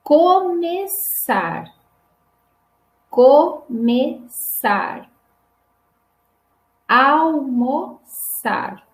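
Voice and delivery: começar, almoçar, alcançar very slowly, syllable by syllable; som de S. Shown as a demonstration. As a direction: hiss a long s for the ç in almoçar. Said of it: "Agora som de S também". (começar, almoçar, alcançar bem devagar, com som de S)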